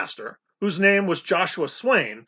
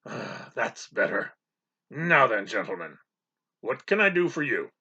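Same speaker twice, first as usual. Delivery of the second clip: calmer; 5 semitones lower, 5 dB quieter than usual. A narrator's reading. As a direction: let the roar go out of the voice. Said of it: "the roar went out of his voice".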